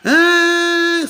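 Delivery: long, high held honk; imitated car horn. Driver leaning on her horn at the child, imitated long honk. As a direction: wail like a horn leaned on, high and steady. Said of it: "I'm wailing on my horn".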